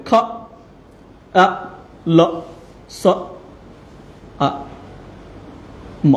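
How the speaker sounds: isolated letter sounds, one by one; sounding out. Shown as a demonstration. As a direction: say each sound separately with long gaps. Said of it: "sounds দিয়ে লিখি".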